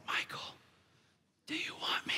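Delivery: high, unvoiced teasing whisper; toy's whisper. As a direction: whisper like a toy calling a child. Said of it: "like, whispered to me".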